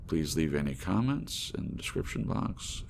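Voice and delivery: plain reading voice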